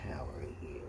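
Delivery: soft low whisper to a pet; hushed little whisper; whispered greeting. Real, not acted. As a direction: whisper it very gently, close to the cat. Cooing softly to the cat.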